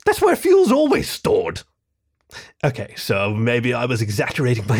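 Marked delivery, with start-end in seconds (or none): 0.0-1.6 high, slow ratty voice; squeaky rat-man insisting slowly; indignant growling rat. A narrator's reading. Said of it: "growled my ratty friend there".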